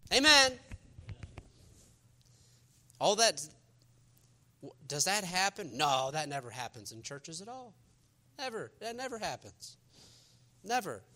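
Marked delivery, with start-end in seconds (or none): 5.8-11.0 sarcastic denial. Acted.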